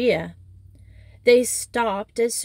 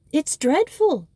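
higher, emphatic disapproval; declaring; 7 semitones higher than usual. Acted. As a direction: pronounce it firmly. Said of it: "declared the mathematics mistress".

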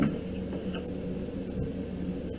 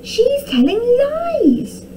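high, loud, drawn-out accusation; angry shout; 5 dB louder, slower than usual. A narrator's reading. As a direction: shout it indignantly, stretching the words. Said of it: "Tom shouted angrily".

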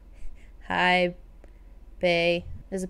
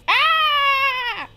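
excited squeal; 3 dB louder than usual. Acted. Squeals over Greg.

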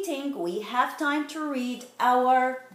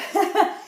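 embarrassed chuckle; 7 semitones higher, 5 dB louder than usual. Real, not acted. Laughs at own slip.

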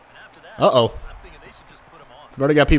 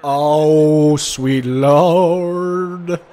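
drawn-out, louder cry of dismay; dismayed exclamation; 2 semitones higher, 4 dB louder, much slower than usual. Real, not acted. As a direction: stretch it out as disaster unfolds. Reacts to a big hit.

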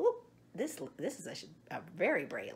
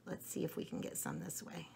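whisper; 13 semitones lower, 9 dB quieter, faster than usual. Real, not acted. Quiet moment candling the egg.

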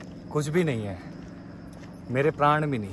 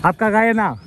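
much higher and louder, teasing through laughter; laughing question; 8 semitones higher, 7 dB louder than usual. Real, not acted. Vlogger teases the man about his cow, laughing question. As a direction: toss the question out while cracking up.

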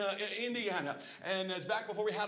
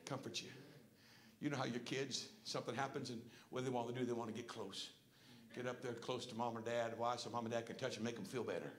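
low, soft, intimate sermon voice; hushed pastoral reassurance; tender preaching; 8 semitones lower, 8 dB quieter than usual. Real, not acted. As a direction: drop down low and speak gently of comfort. Preacher drops to tender talk.

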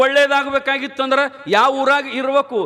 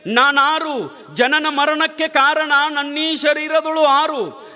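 raised chanting recital of a verse; poem recital; 5 semitones higher than usual. Shown as a demonstration. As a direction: intone the old poem higher, line by line. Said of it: "ನಿಜಗುಂಡ್ರು ಬರೀತಾರ ನೋಡ್ರಿ".

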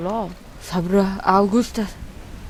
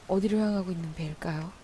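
whisper; 3 semitones lower, 8 dB quieter than usual. Real, not acted. Secretly tailing a ship.

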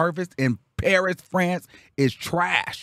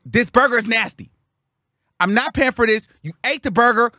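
raised voice, higher and insistent; indignant refusal; 7 semitones higher, 4 dB louder than usual. Retold or imitated. Refusing to pay the man for the burger, indignant. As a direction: stand your ground, pitch climbing.

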